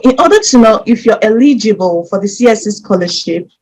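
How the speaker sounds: ordinary delivery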